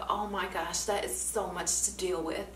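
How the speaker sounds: quiet, soothing therapist voice; soft sympathy. Retold or imitated. Therapist to crying student, hushed sympathy. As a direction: say it gently, hushed. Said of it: "And he's just like".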